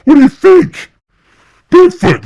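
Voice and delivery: high, loud exasperated shout; panicked yell. Retold or imitated. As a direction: shout it as if it's obvious. Richie answering, still panicked.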